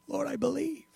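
soft, high, mock-tearful voice; tearful plea. The father crying out to Jesus, acted tearful. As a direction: plead softly as if through tears. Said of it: "He's crying now".

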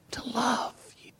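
sudden hushed whisper after the build. Acted. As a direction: drop to a breath. Drop to whisper after climax.

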